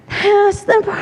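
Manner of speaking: high, squeaky nagging inner voice; high nagging voice of self-doubt; high-pitched inner voice. Shown as a demonstration. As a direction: do the high little voice in her head. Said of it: "she sounds a little like this".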